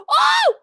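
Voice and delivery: sudden high loud cry of dismay; shocked shout. Real, not acted. Fan reacting to a bad spot, loud high cry. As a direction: yelp out at the screen.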